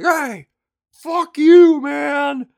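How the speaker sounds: high, furious yell; angry shout. Retold or imitated. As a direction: scream it at someone's back. Guy yells after knifeman.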